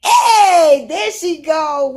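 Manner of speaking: loud, high cheer of welcome; excited shout. Real, not acted. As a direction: shout it as she finally comes on. Guest finally joins the stream.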